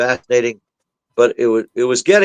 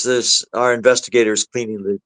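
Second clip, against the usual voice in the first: normal talking voice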